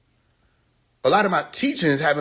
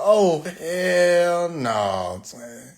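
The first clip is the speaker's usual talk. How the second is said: long incredulous hell no; drawn-out disbelief; much slower than usual. Real, not acted. Reacting to the comments, drawn-out laughing disbelief. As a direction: stretch it way out, laughing.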